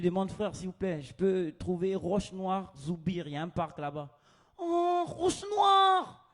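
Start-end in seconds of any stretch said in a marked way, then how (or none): 4.6-6.2 high, loud, drawn-out welcome; excited Moroccan local. Retold or imitated. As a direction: greet the stranger with big enthusiasm.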